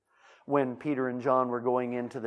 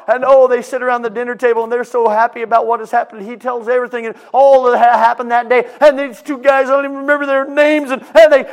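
high, loud preacher building excitement; excited preacher narrating a miracle; fiery storytelling preaching; 12 semitones higher, 18 dB louder than usual. Acted. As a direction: preach the story with rising fire. Preaching at full force.

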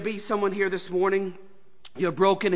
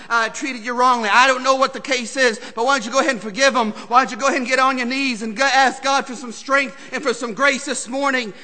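louder, driving sermon cadence; preaching at full force; 4 semitones higher, 8 dB louder than usual. Acted. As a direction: press the point home.

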